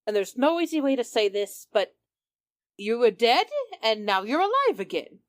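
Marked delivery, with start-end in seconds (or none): none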